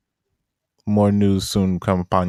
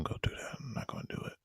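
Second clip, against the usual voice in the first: whisper; 21 dB quieter, much faster than usual. Real, not acted. Gives up on rant.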